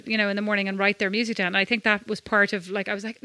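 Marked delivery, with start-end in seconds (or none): none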